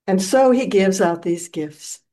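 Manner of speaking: ordinary talk, nothing marked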